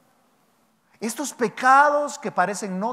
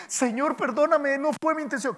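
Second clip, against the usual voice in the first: mock plea; 6 semitones higher than usual. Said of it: "uno dice".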